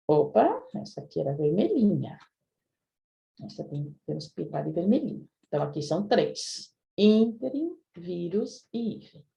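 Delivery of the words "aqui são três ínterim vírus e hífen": normal talking voice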